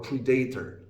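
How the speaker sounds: "predator" as pre-DAY-ter; wrong stress. Shown as a demonstration. Teacher rejecting a non-word, "predator" stressed on DAY. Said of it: "There is no word".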